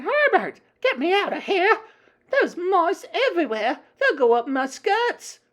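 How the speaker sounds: high, loud, hysterical wife; shrill screaming. A narrator's reading. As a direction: shriek at your husband to save you. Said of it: "she was screaming".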